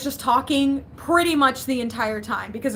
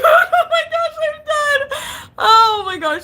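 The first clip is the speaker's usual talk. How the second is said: very high, loud squeal of laughter; hysterical laughing; 14 semitones higher, 5 dB louder, slower than usual. Real, not acted. Streamer losing it at a chat comment, shrieking with laughter. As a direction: shriek it helplessly through real laughter.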